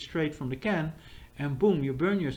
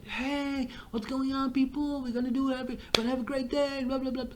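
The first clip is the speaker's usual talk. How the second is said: much higher, bursting with morning energy; hyped-up greeting; 10 semitones higher than usual. Retold or imitated. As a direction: pump the listener up like a hype host. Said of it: "you're getting pumped with energy".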